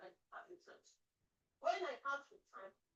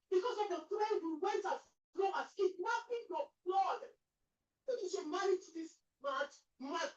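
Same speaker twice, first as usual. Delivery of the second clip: crying angry accusation; 4 semitones higher, 4 dB louder than usual. Acted. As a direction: shout it through tears at him. Mother accuses son, crying.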